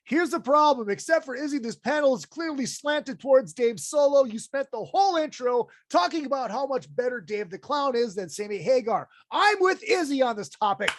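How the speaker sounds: mock outraged viewer reading. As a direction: voice the fan's gripe as a hammed-up rant. Reading viewer's critical comment.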